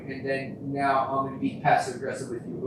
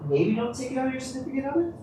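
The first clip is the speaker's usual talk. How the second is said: high, quick, amused lilt; mock-gentle suggestion; 10 semitones higher, faster than usual. Man voicing his late realisation about his partner, mock-gentle. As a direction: pitch it up lightly, close to laughing.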